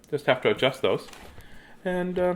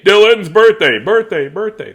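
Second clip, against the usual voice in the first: louder echo; 8 semitones higher, 9 dB louder than usual. Shown as a demonstration. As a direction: echo it back, louder and higher. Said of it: "We echo".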